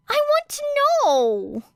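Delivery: put-on high, loud childish whine; whiny. A narrator's reading. Uraraka denied the answer, whining high and loud. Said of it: "whined Uraraka".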